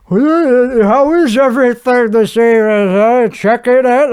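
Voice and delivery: imitating an over-courteous hotel servant; mustered-up servant. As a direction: stretch it out like a forced polite clerk. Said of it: "to muster up a servant".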